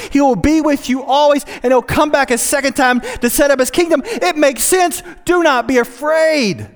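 rally frightened people back in time; exhorting counsel. Pretend. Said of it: "counsel them and exhort them".

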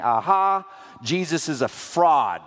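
a jeering scribe, lightly voiced; mocking gloat. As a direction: crow over the apostles' failure like a smug scribe.

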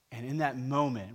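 normal talking voice

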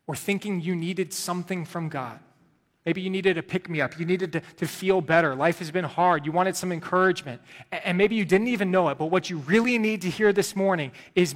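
earnest sermon appeal; preaching. Acted. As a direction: plead with the congregation. Preaching at full force.

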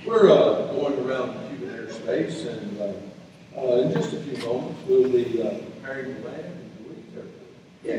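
low, soft, hesitant cockpit announcement; calm pilot announcement. Retold or imitated. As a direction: imitate an unruffled captain on the intercom. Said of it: "then he come across in English".

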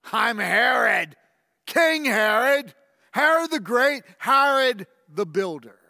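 loud, grand royal declaration; proud proclamation. Acted. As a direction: announce yourself like a conquering king. Self-proclamation.

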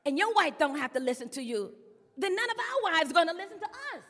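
loud acted protest, climbing high; pressuring the king. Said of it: "They put the pressure on him".

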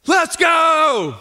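high, loud pumped-up shout; team shout. Retold or imitated. Team after the coach's pregame talk, loud cheer. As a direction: yell it like a fired-up team.

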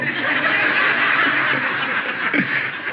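high breathy burst of laughter; real amused laughter. Real, not acted. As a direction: laugh at the punchline. Laughing at own joke.

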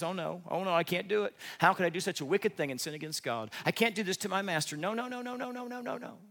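rising panicky no no no; Joseph refusing. Retold or imitated. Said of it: "He says".

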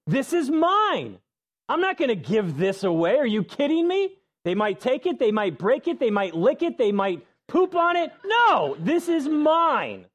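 put-on a toddler who won't give it up; possessive child. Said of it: "you ask a kid to share".

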